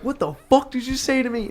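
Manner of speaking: high-pitched retold challenge; angry confrontation. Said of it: "And I'm like".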